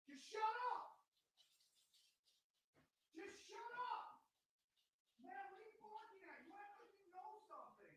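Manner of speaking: high shout from far off mic; far-off shout at the barking dog; distant yelling. Real, not acted. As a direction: holler at the dog from another room. Yelling at dog from another room.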